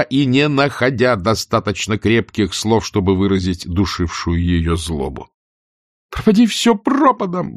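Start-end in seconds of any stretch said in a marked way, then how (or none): 6.1-7.6 much higher, bursting curse; outcry. Acted. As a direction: spit it out in helpless rage. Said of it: "выкрикнула она наконец". (в бессильной ярости выкрикнув)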